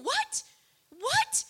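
stunned wife asking what; shocked question. Retold or imitated. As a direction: squeak it out in shock. Wife shocked by husband's words.

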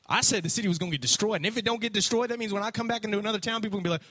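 high, fast mock whine; comic high-pitched griping; petulant Jonah. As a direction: rattle it off as a sulky complaint. Jonah whining about his reputation.

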